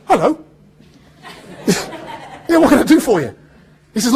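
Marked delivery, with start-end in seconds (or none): 0.1-0.4 startled hello. Retold or imitated. Answering the Twenty-four producer, startled. Famous producer calls his mobile. 1.7-3.3 laughing. Retold or imitated. Acting casual to the producer.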